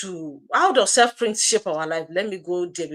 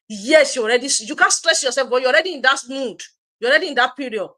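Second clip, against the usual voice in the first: higher, louder, insistent comeback; emphatic rebuttal; 6 semitones higher, 6 dB louder than usual. Real, not acted. Coach answers the excuse-maker back, emphatically insistent. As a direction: push back hard on the excuse.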